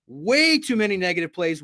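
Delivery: usual speaking voice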